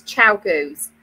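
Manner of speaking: slow, settled "Chow Goos", CHOW then GOOS; confirmed pronunciation. Shown as a demonstration. Host confirming the brand name, confirmed pronunciation. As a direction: say "Chow Goos" unhurriedly, CHOW as in ciao. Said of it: "a, a pronunciation".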